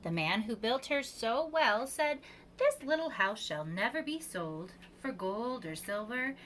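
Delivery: plain reading voice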